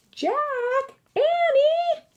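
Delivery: high, long call from far off; distant cry. A narrator's reading. Mother calls again from the house, distant. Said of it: "came the distant cry again".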